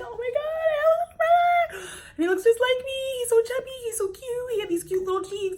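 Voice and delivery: very high, tearful-sounding gush; overwhelmed squealing. Retold or imitated. Meeting her little brother for the first time, squealing excitement. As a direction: squeal as if melting over a baby brother.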